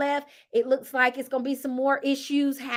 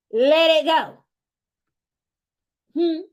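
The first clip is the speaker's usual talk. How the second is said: ordinary talk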